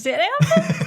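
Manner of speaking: high laughing echo; echoing it back through laughter. Real, not acted.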